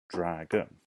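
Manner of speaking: "Dragon" in two distinct beats; syllable by syllable. Shown as a demonstration. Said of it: "I think it's two".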